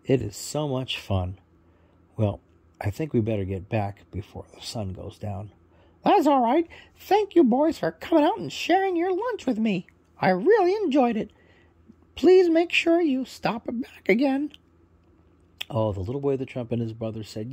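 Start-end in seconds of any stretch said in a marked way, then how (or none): 6.0-14.5 high, loud squawky parrot; proud parrot introducing himself, high and loud. Acted. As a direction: chirp it out as a cheerful parrot bird. Parrot says goodbye.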